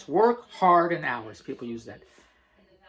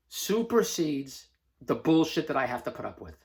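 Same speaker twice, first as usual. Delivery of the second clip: normal talking voice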